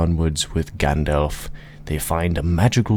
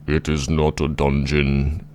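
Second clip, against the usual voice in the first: mighty voice of a looming giant. Acted. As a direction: stretch each word out like a huge giant. Giant corrects Bilbo in story.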